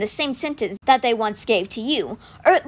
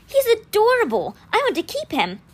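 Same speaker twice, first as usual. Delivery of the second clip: adoring; 11 semitones higher than usual. Acted. Megan grinned.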